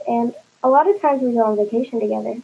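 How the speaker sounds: plain reading voice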